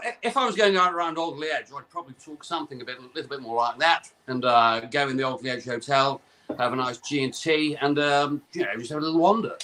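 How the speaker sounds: put-on posh Cheshire voice; posh Cheshire accent. Shown as a demonstration. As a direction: chat like well-to-do Cheshire. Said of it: "have you got a nice Cheshire posh accent for us?".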